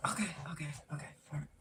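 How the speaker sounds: very soft, near-whispered reassurance; low murmur to calm someone panicking; hushed placating. Retold or imitated. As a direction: imitate mom quietly giving in. Mom placating her panicking child.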